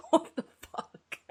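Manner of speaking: laughing. Real, not acted. Laughing in disbelief.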